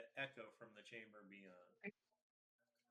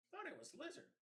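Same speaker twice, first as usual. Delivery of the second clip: squeaky goblin; faster than usual. Acted. Goblins chatter over food.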